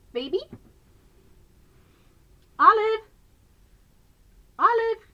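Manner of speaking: high, loud call across the room; calling out for the dog. Real, not acted. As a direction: sing out the dog's name to fetch her.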